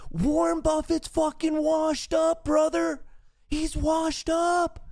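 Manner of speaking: very high, wild victory voice; cocky over-the-top celebration; gloating hype. Real, not acted. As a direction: crow like you just won big. Gloating after winning trade.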